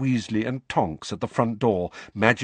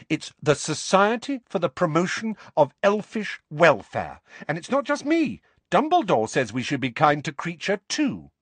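indignant, high-pitched insistence; Hermione, heated; 7 semitones higher than usual. A narrator's reading. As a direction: argue the cause heatedly in a higher voice. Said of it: "said Hermione heatedly".

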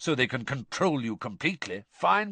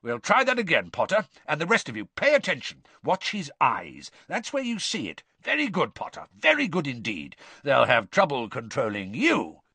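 higher, emphatic teacher's exclamations; rousing praise; 5 semitones higher, 4 dB louder than usual. A narrator's reading. Teacher showing off Potter to the class, excited and forceful. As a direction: crow about the student's fight to everyone.

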